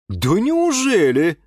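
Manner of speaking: slow, high-pitched astonishment; surprised. A narrator's reading. Mother reacting to the TV host, surprised. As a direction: draw it out in surprise. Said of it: "удивилась мама Юры".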